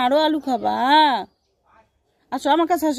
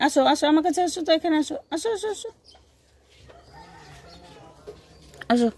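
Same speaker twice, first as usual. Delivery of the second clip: rising, softer coaxing call; coaxing pet talk; 3 dB quieter, faster than usual. Real, not acted. Coaxing the pet cat to come closer, soft and rising. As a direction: sweet-talk the animal closer.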